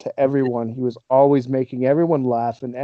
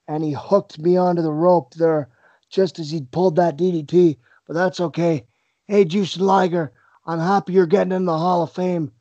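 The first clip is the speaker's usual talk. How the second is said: slightly raised, put-on wrestler promo voice; Owen Hart; 3 semitones higher than usual. Wrestler addressing the rival who just beat him, impersonated voice. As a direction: impersonate a wrestler griping while claiming to be gracious. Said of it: "impersonation before we wrap up here".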